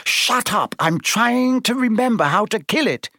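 high-pitched exasperation; flustered snapping. A narrator's reading. Hermione to Ron while trying to remember, snapping. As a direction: snap back, voice pitched high. Girl snaps back under pressure.

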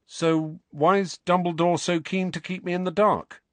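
high, forcedly casual question. A narrator's reading. Harry asking why he is kept in the dark, forced casual. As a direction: make it sound casual, with effort. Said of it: "trying hard to keep his voice casual".

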